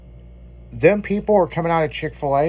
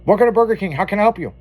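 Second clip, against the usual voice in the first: chirpy scripted fast-food greeting; corporate cashier voice; 6 semitones higher, 4 dB louder, much faster than usual. Retold or imitated. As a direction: play the overly polite drive-thru worker.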